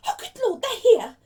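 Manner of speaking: very high, loud, flustered exclamation; frantic. Acted. Aunt hearing guests at the door, frantic. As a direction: panic shrilly at the visitors' arrival. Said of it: "said Aunt Petunia frantically".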